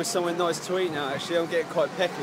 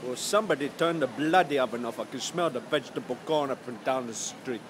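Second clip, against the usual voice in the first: mock Indian accent. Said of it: "the worst Indian accent I've ever done".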